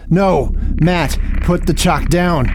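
panicked. Acted. Realizing the summoning.

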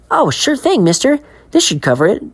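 put-on eager boy. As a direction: chirp it like a keen kid. Billy answering the man.

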